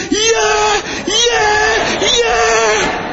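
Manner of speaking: high, cracking shouts of joy; triumphant yell. Retold or imitated. Preacher jumping up as his Jamaican runner wins, high joyful yells. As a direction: leap up and yell for the win. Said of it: "I just got up and I said".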